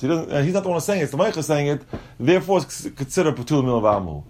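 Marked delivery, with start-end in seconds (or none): none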